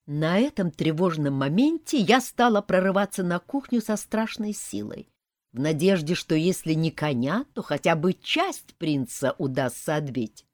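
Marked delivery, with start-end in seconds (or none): none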